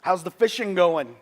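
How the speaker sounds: high, loud friendly call across the water; calling from the shore. Retold or imitated. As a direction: call out to someone far off on the shore. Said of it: "I hear a voice from the shore say".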